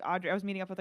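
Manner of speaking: ordinary talk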